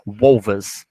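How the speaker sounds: "Wolves" said wol-ves, with a buzzing V and an s at the end; V sound in plural. Shown as a demonstration. Teacher repeating "Wolves" for students, clear V before the s. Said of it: "Até a pronúncia ganha uma entonação diferente".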